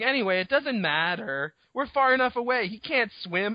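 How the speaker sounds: high-pitched imitation of a know-it-all girl; mock little girl. Girl insisting the T-Rex can't swim, mock girlish voice. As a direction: pitch it up, mocking her breezy certainty. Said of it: "And then she's like".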